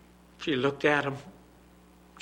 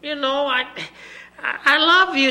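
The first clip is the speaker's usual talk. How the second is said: put-on torn little girl; 11 semitones higher, 9 dB louder than usual. Little girl refuses to give daddy her pearls, high. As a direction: plead while torn. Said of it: "little girl pleads".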